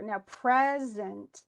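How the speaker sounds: "present" slow, first syllable hit hard; stress on PRESENT. Shown as a demonstration. Teacher showing the stress, drawing out "present". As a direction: lean on the start of "present". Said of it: "the present is stressed".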